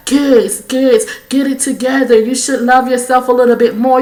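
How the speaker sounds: high, loud lecturing voice; preachy scolding. Retold or imitated. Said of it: "you motherfuckers would be like".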